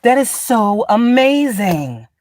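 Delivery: high drawn-out so amazing; mock amazement. Mocking the awe people expect from her. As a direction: gush with slow fake awe. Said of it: "They want you to be like".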